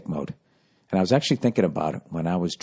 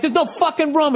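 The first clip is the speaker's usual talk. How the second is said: high, strained yell in an argument; exasperated high-pitched shouting. Retold or imitated. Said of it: "I'm yelling at the guy".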